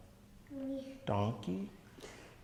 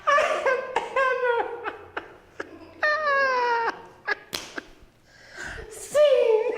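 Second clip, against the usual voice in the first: high, squealing daughter bursting with laughter; laughing daughter; 4 dB louder, slower than usual. Acted. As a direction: laugh through every word like a delighted little girl. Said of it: "she was laughing".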